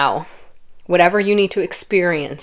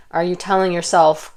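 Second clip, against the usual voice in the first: ordinary talk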